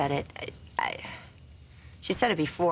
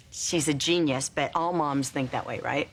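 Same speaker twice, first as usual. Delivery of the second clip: usual speaking voice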